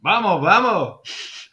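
high, slow, loud call of vamos; rally the team like a Latin teammate; calling out in Spanish. Retold or imitated. Imitating teammates shouting encouragement on the field, loud drawn-out call.

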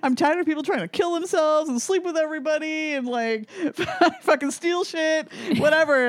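lightly hammed-up retold grievance; mock fed-up complaint. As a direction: rant as a teen who has had enough. Acted girl fed up with band.

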